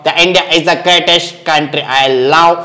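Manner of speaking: put-on very slow, deliberate accented English; mimicked foreign celebrity. Mimic praises India in very slow English. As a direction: drag out each English word like a visiting star.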